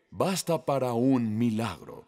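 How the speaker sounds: ordinary talk, nothing marked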